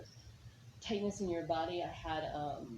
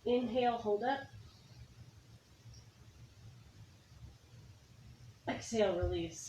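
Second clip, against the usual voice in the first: energetic coaching cues; 4 semitones higher, 5 dB quieter than usual. Acted. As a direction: push the class through the breath. Yoga teacher calls out breath cues.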